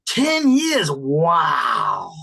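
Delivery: astonished teacher, big swooping intonation; amazed. Real, not acted. Teacher amazed at student's answer.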